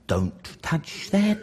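soft, slow inner warning voice. Retold or imitated. Brain warning him off the greasy chicken, soft and slow. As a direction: murmur it slowly like a voice in your head. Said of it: "part of my brain is going".

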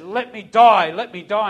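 first cry loud, second easing; acted desperate plea. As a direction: re-enact a desperate man's outburst. Suicidal man's plea acted out.

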